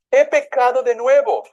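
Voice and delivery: high, loud outcry of confession; Judas's cry. A narrator's reading. Judas confesses his betrayal before the priests, crying out. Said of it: "gritó Judas".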